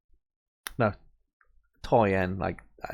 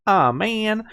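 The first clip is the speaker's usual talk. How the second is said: high, loud cartoon "Oh, man"; Swiper; 11 semitones higher, 4 dB louder than usual. Imitating Swiper from Dora the Explorer, sulky and high. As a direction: pout it out like Swiper foiled. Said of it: "Swiper goes".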